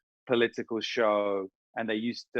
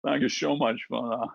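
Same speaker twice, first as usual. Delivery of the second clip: slushy Scottish film-star impression; Sean Connery; 3 semitones higher than usual. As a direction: do the famous old Scottish star. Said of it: "from the Sean Connery impression".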